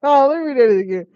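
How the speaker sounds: loud amused reaction; excited outburst. Real, not acted. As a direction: burst out laughing at the line. Reacting loudly to the script.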